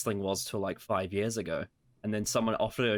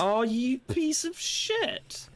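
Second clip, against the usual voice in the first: mock outrage; much slower than usual. Mock insult over slow download.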